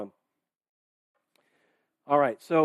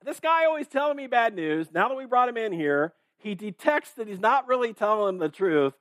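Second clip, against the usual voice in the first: high-pitched mock complaint; exasperated king; 6 semitones higher than usual. King complaining about the prophet's bad news, exasperated. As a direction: grumble in a raised, incredulous pitch.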